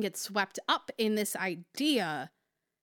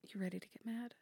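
very soft, near whisper; hushed question; 14 dB quieter, faster than usual. Real, not acted. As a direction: ask it under your breath. Hushed check before the rant.

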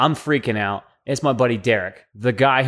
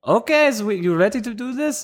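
high-pitched, badly faked German accent; terrible German accent; 8 semitones higher than usual. German doctor returning to begin, terrible German accent. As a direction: imitate a German lady with a clumsy accent. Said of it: "That's my terrible German".

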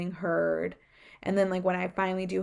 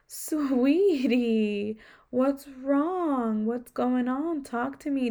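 imitating a caring doctor, pitched higher; nurturing doctor; 5 semitones higher, much slower than usual. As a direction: coax her like a kind doctor would. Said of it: "soft spoken voice, nurturing energy".

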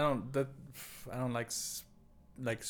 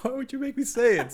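laughing through the words, higher; laughing protest; 12 semitones higher, 6 dB louder than usual. Real, not acted. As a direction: protest while cracking up.

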